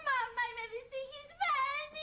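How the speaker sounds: slow, drawn-out crying wail; wailing sob. Acted. Bride to her mother, slow sobbing wail. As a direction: wail it to mama, slowly.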